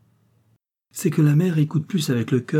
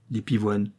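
ordinary delivery, nothing marked

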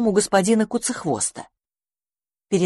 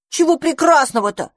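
high, loud, sulky grumble; grumbling boy; 9 semitones higher, 4 dB louder than usual. Acted. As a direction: grumble it like a grumpy little house-sprite. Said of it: "пробурчал мышевраг".

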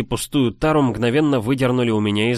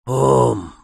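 pained groan; 3 semitones higher, 4 dB louder than usual. Acted. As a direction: groan in pain. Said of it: "Парень застонал".

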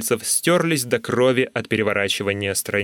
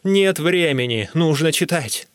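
obstinate, pitched-up protest; stubborn insistence; 6 semitones higher, 3 dB louder than usual. A narrator's reading. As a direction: insist like someone who won't be moved. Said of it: "упрямо возразил я".

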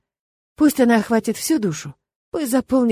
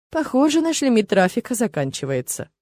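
usual speaking voice

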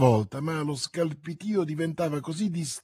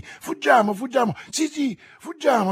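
much higher, loud frantic pleading; panicked repeating; 10 semitones higher, 5 dB louder than usual. A narrator's reading. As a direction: babble in panic, nailed by terror. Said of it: "ripeté Madame Bonacieux". (supplica acuta e terrorizzata, ripetuta)

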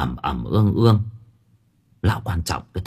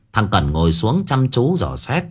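ordinary delivery

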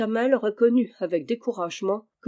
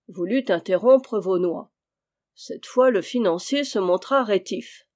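plain reading voice

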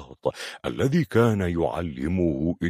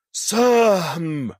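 long drawn-out shout, much higher; 13 semitones higher, 6 dB louder, much slower than usual. Acted. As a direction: call the name out at full voice. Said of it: "وهو يصيح".

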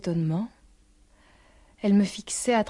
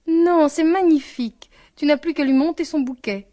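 delighted exclamation; 6 semitones higher, 5 dB louder than usual. A narrator's reading. Father hearing that Anne is in her room, delighted exclamation. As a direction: exclaim with pleasure, voice lifted.

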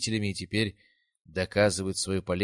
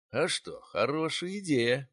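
playing along; 7 semitones higher, slower than usual. A narrator's reading. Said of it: "подыграл я девчонке".

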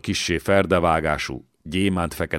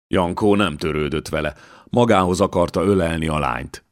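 ordinary delivery, nothing marked

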